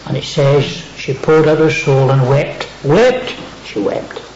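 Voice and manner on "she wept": whispered. Acted. Preacher whispering to the congregation. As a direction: whisper it, voice dropped right down.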